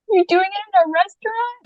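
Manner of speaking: can't believe he's proposing right here; shocked surprise. Retold or imitated. To her boyfriend kneeling in the restaurant, high shocked disbelief.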